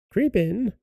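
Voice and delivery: high, coaxing witch voice. A narrator's reading. Witch luring Gretel into the oven, high voice. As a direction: coax her in with a high witch voice. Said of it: "said the witch".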